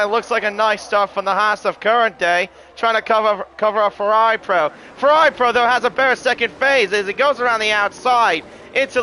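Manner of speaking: lively play-by-play race call; motorsport commentator. Acted. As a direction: call the race start as it happens.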